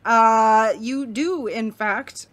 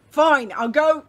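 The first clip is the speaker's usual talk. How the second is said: a touch louder, fake tough; mildly louder gruff bluster; acting tough; 5 semitones higher, 7 dB louder, slower than usual. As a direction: bluster to hide nerves. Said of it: "he's acting tough".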